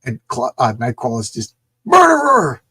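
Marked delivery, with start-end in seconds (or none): 1.8-2.6 high, loud accusing cry; hammed-up outraged yell; furious shout. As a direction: scream it at the killer. Nightcrawler cries out in fury.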